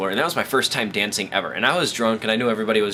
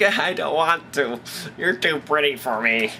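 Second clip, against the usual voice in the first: slow, drawn-out whiny refusal; mocking crybaby voice; mock whining; 4 semitones higher, slower than usual. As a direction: whimper it like a reluctant wimp, hammed up. Mocks a man refusing a girl.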